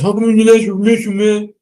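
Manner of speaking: high, fast squeak of a scared man; cowering chicken voice. Retold or imitated. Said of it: "like a little chicken".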